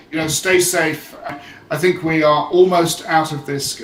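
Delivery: ordinary delivery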